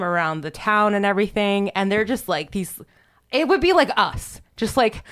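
ordinary talk, nothing marked

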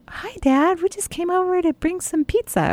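bright, high, breezy greeting; cheery visiting voice. Pretend. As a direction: act casual, just dropping by with pizza. Suggests a casual visit to dad.

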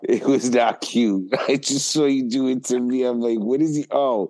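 high, laughing through the words; giggling in disbelief; real laughter while teasing. Real, not acted. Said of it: "laughing at friend's Corey face".

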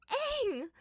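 very high laughing cry. Acted. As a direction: shriek his name through laughter. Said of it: "Azula roared with laughter".

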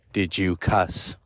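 low, slow interrogation; stern teacher. Retold or imitated. Teacher asking a pupil who cussed, stern and slow. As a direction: spell out the question slowly in a low teacher voice. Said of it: "I said it just like that".

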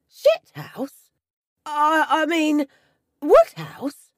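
loud, high, startled slip of the tongue; incredulous. A narrator's reading. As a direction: blurt it out in disbelief. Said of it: "with incredulity".

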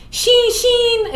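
"Sheen Sheen" said high and loud with a Brooklyn twang; Brooklyn mispronunciation. Retold or imitated. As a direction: call "Sheen Sheen" like a Brooklyn drill instructor. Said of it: "she could not say his name".

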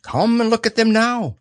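high-pitched owl summons. Acted. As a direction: urge him along in a high owl voice. Owl urges Pooh to look.

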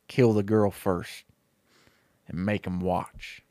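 low, slow and soft menace; cold villain order. Acted. Stinky Pete ordering his men to kill Jesse first, cold and slow. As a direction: let the cruelty sink in slowly.

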